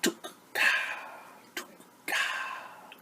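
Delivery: clicking breathy tuk ka; lizard call. Acted. Teacher imitating a lizard's call for children. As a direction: click it out like a gecko. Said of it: "A long-tailed lizard".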